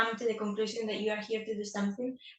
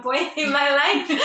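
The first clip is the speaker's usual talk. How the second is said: laughing; 5 semitones higher, 9 dB louder than usual. Real, not acted. Laughing at herself.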